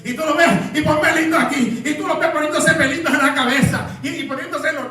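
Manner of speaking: high-pitched, driving sermon delivery; predicación enérgica. Acted. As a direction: preach it hard, pushing the pitch up. Preaches at full force. (prédica encendida)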